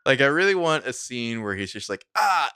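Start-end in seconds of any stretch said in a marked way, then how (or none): 2.2-2.5 loud, high "ah" of sudden pain; mock pained cry. Holden grabbing his chest for a joke, mock pained cry. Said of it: "suddenly, like, grabs his chest".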